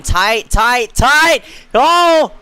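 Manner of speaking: rising excited shout; excited building call. Real, not acted. Race finish too close to call.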